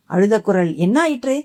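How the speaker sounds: plain reading voice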